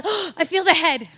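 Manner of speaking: loud, high urgent cry; yelling. Retold or imitated. Calling to husband mid-labor, loud urgent yell. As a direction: shout it in alarm. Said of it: "And I yelled".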